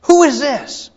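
high, loud questioning cry; alarmed city crowd. Retold or imitated. Preacher voicing the stirred-up city, high and loud. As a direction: call out in excited confusion.

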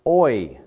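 "oi" voiced like a sigh of grief. Shown as a demonstration. Congregation hears the Hebrew "oi", groaned. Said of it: "It is".

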